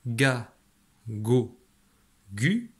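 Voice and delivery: "ga, go, gu," each sounded alone with hard g; hard g syllables. Shown as a demonstration. Teacher showing what g gives before a, o, u, hard g syllables. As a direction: separate "ga, go, gu," with pauses. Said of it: "Donc ça donnera".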